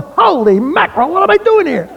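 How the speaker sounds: high-pitched mock astonishment; retold bewildered exclamation. New security agent amid a yelling crowd, retold bewilderment. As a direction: exclaim it in disbelief, pitch climbing.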